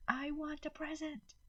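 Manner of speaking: put-on high, far child's voice, muffled wail; a small girl wailing for a present; screaming child. A narrator's reading. Little Maddie wailing into her mother's shoulder, high child voice. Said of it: "Maddie screamed into her shoulder".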